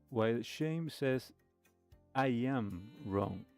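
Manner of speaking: ordinary delivery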